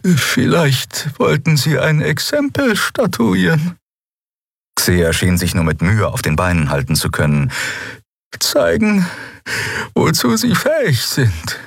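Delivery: barely holding together; shaken woman. A narrator's reading. Xea, weak and shaken. (hoch, langsam, erschüttert)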